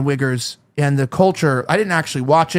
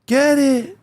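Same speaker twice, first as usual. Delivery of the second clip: high-pitched mock hype; stretched, put-on cheering; 6 semitones higher, slower than usual. Mimicking how they always talk, exaggerated high cheer. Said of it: "they always say".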